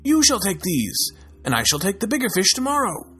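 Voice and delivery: insisting. Acted. Anene insisting on bigger fish.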